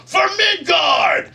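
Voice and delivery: slow, high shouted rallying cry; huge drawn-out war shout; heroic battle cry. Retold or imitated. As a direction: roar it like a hero charging in. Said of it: "and he goes".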